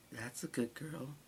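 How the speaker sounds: soft slow menacing murmur; sinister whispered praise; creepy hush. Acted. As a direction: coo it cruelly. Beast's last words.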